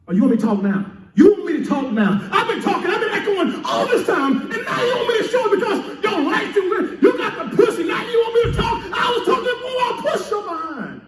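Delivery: high, laughing, indignant rant; mock outrage building high; God scolding, indignant. God scolding the person who only prays now, high and indignant. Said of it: "God said".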